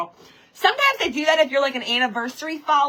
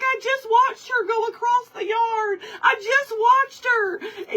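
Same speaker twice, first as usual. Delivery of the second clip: high, drawn-out retold lament; mock distraught wail; 9 semitones higher than usual. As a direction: whine it high as if grieving. Retold grieving over last sighting.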